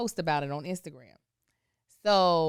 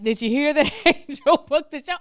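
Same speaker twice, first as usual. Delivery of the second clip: laughing news; 7 semitones higher, 9 dB louder than usual. Retold or imitated. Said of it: "was like".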